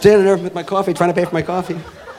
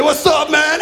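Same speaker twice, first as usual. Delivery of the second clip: higher, shouted mock "What's up, man"; wannabe gangster greeting; 9 semitones higher, slower than usual. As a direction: ham up the kid's street act. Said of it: "He's waving gang signs at me".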